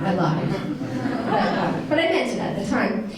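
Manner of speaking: chuckling admission; amused, laughing own-up; laughing confession. Real, not acted.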